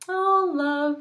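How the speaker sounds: high, drawn-out lovey sigh; dreamy gushing. Shown as a demonstration. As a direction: swoon over the word. Sighing dreamily over the word love.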